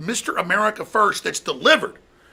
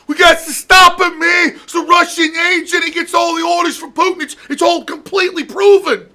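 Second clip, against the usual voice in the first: much higher, loud shouting parody; mock Deutsch rant; 11 semitones higher, 8 dB louder than usual. Mocking Deutsch calling Trump Russian agent, high shouted parody. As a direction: shriek it like a panicked pundit. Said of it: "he goes on, he's like".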